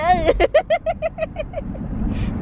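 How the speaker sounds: high squealing giggle. Real, not acted. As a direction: giggle helplessly while tickled. Laughing while tickled.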